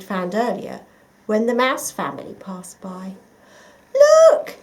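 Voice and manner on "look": high loud exclamation; exclaiming. A narrator's reading. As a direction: exclaim in delight. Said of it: "exclaimed Missus Mouse".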